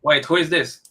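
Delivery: ordinary delivery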